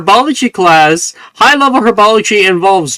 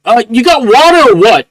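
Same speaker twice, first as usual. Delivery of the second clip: impatient; 6 semitones higher, 5 dB louder than usual. Acted. Impatient flower demands water.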